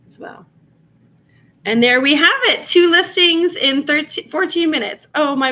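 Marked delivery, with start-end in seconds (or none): 1.6-5.5 excited. Real, not acted. Proud of quick listing time.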